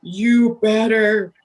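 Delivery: slow, menacing deep warning. Retold or imitated. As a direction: drag it out slow as a low threat. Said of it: "my deep voice".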